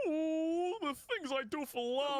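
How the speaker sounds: high, whiny cartoon dog voice; trembling whiny yelps. Shown as a demonstration. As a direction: whimper it like a scared cartoon dog. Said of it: "doing a Courage the Cowardly Dog voice".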